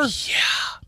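hushed whisper; breathy unvoiced whisper. Real, not acted. Whispered answer.

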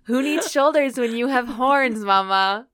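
playful sass. Pretend. Joking about monster lovers.

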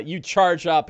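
ordinary delivery

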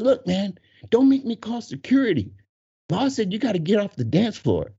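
high-pitched, insistent imitation; retold bouncer's warning. Employee threatening to call security, high insistence.

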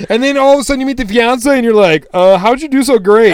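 loud, high-pitched indignant rant; worked-up comic indignation; mock outrage. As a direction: protest like you've been snubbed. Ranting at customers who prefer fiancé.

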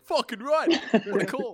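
high-pitched mock enthusiasm; hammed-up excited approval; imagined keen fan. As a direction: cheer it on like a lone devoted fan. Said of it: "that one person's like".

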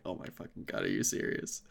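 very low, almost voiceless exasperation; hushed mock disbelief. Fan recalling his reaction to the line, hushed disbelief. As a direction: drop to a stunned whisper. Acted disbelief at the wrong catchphrase.

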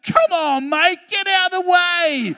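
high, loud yell at another driver; a child yelling out at traffic; kid yelling. Retold or imitated. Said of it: "she yells out".

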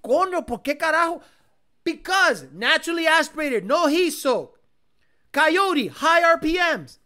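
hammed-up boss dismissing the Raptor; mock cartel boss. Streamer as cartel boss dissing the Raptor R, loud.

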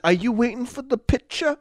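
Andrew Dice Clay. Acted. Comic asking a stranger on the street, mock New York voice. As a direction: imitate the cocky comic accosting someone. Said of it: "And if he says".

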